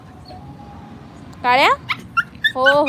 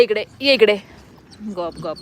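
ordinary delivery

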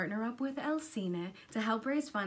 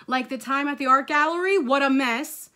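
loud, raised exasperation; worked-up motherly lecture; scolding mom; 4 semitones higher, 12 dB louder than usual. Acted. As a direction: remind the kid of past trouble. Mother lists past gum disasters.

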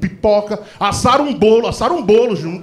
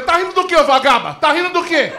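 furious taunting daughter; 7 semitones higher than usual. Retold or imitated. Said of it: "Minha filha".

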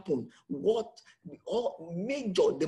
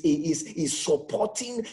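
ordinary talk, nothing marked

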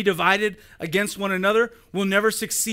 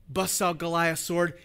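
usual speaking voice

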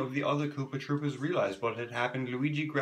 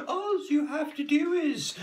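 princess voice; 5 dB louder than usual. A narrator's reading. Princess voice continues.

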